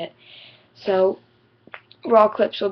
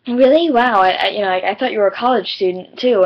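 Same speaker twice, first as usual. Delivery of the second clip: amazed retold kid; 7 dB louder than usual. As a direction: kid startled to learn she is not in college. Kid amazed she's in high school.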